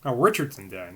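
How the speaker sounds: ordinary delivery, nothing marked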